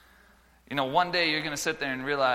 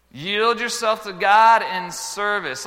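slow loud high proclaiming; preacher announcing his second point; emphatic point; 8 semitones higher, 8 dB louder, much slower than usual. Acted. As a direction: declare the heading slowly.

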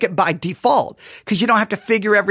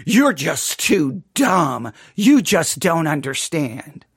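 mock condescension; 7 semitones lower, slower than usual. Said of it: "It'll be like".

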